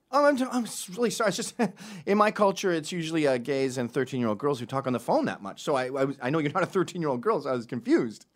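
faux-sorry explanation, easing down as it goes; mock apology. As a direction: backpedal with fake innocence. Fake apology to the cabbie.

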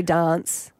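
"dance" with a long ah, as in "dahnce"; posher Adelaide vowel. Shown as a demonstration. Host showing how Adelaide people talk, "dance" with broad posh ah. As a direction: say "dance" the posh South Australian way. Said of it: "They say dance".

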